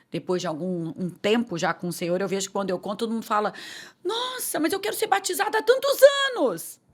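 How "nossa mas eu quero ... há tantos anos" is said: high-pitched imitation of envious churchgoers; resentful complaint. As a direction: mimic a wounded, indignant lament. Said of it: "começassem até a ficar ressentidas". (imitando queixa ressentida, em voz bem aguda)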